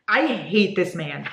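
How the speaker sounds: normal talking voice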